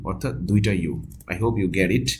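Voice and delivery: ordinary talk, nothing marked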